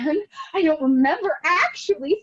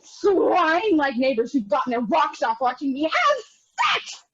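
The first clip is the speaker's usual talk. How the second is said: ordinary talk, nothing marked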